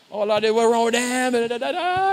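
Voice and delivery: judging onlooker. Retold or imitated.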